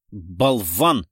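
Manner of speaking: forceful, annoyed muttered insult; cursing to himself. A narrator's reading. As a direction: spit out a private curse. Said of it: "выругался про себя Дронго". (досадливое ругательство про себя)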